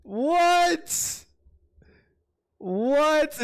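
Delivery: high, loud, drawn-out "What?"; can't believe what he just heard; stunned disbelief. Real, not acted. Streamer reacting to the rant, stunned high disbelief.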